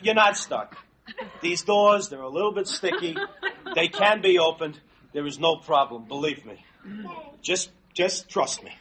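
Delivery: low, soft, halting reassurance; forced calm. Acted. Husband reassures wife stuck in phone booth, forced calm.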